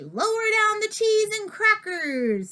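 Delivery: high, slow, drawn-out call; hammed-up pirate holler; pirate warning cry. A narrator's reading. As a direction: call a mock alarm across the deck.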